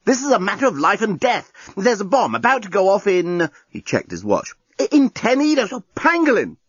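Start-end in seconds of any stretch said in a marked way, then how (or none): 0.0-3.5 urgent panic. A narrator's reading. Journalist panicking about bomb. 4.8-6.6 much higher, flustered and stammering; frantic stammer. A narrator's reading. Journalist swearing, stammering.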